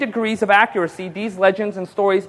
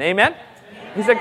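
ordinary delivery

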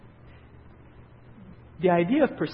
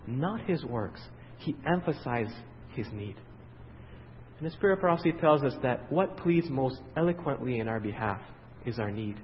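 ordinary talk, nothing marked

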